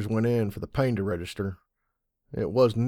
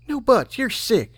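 much higher, louder insisting filly; insistent filly; 13 semitones higher, 6 dB louder, slower than usual. A narrator's reading. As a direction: insist like a stubborn little filly.

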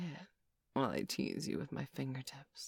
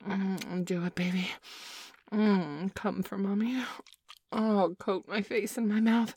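louder, insistent, thrilled urging; eager urging; 4 semitones higher, 6 dB louder than usual. Acted. Mommy urging her girl at the climax, eager and loud. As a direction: push her over the edge with excitement.

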